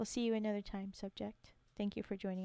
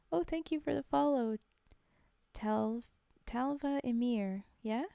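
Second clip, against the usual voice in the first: normal talking voice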